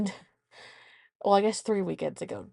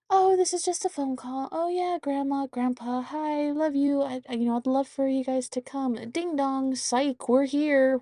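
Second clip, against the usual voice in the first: put-on child voice; 6 semitones higher than usual. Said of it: "she just had to have felt".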